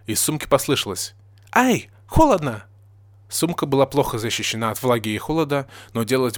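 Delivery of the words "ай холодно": slow, high-pitched complaint; yelping from cold. A narrator's reading. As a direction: yelp at the chill and whine. Character cries from inside cold bag.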